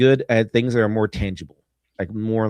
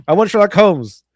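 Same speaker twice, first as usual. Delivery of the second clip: demanding publisher; 7 semitones higher, 6 dB louder than usual. Retold or imitated.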